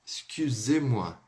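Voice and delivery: "Excusez-moi" drawn out slowly, the u pouted; slow demo. Shown as a demonstration. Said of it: "mais familiar e mais polida". ("Excusez-moi" bem devagar)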